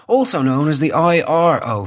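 usual speaking voice

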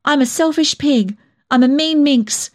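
high, upset self-reproach; near tears. A narrator's reading. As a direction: protest and berate yourself, close to crying. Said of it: "I was near to tears".

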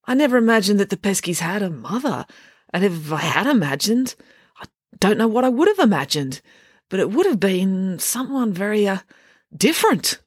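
lightly voiced flustered man, rising as he fumbles; a hint of a bewildered deputy, pitch climbing on different; flustered teacher. A narrator's reading. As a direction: stammer it out, lost for words. Mister Lang flustered speech.

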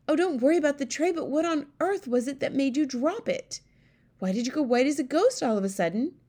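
fast, high, insistent questioning; exasperated queen. A narrator's reading. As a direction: press the maid impatiently for an answer. Queen presses maid for answers.

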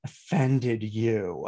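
"offended you" drawn out slowly and low in scorn; "offended you" spoken slowly and mockingly; mocking stress. Shown as a demonstration. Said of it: "made it worse with".

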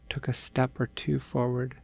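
usual speaking voice

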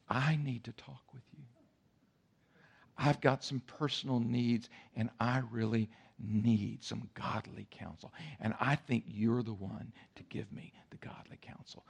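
a guest whispering to the pastor for private counsel; confiding hush. Retold or imitated. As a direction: lean in and breathe it secretively. Guest asks privately, seductive.

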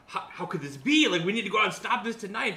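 mock fiery call to action; mock passionate outrage. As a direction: ham up a worked-up brother. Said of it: "Who was this passionate brother?".

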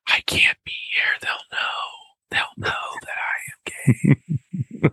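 slow, drawn-out frightened whisper; mock panicked whisper. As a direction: whisper like you're sure everyone will find out.

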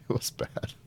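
half-laughed under the breath; laughing whisper. Real, not acted. Repeats verdict with a laugh.

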